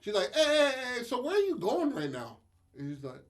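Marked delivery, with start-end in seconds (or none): 0.3-2.4 high, insistent mock voice; imitated interjection. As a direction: cut in high to stop someone leaving. Woman on phone stops him.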